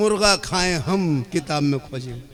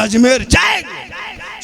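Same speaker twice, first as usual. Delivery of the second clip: louder, higher, slow angry shout; hot-tempered shout; 9 semitones higher, 4 dB louder, much slower than usual. Acted. Husband shouts at his wife that he will go. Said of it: "शोहर गरम".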